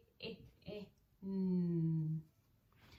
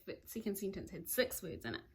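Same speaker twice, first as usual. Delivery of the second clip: ordinary talk, nothing marked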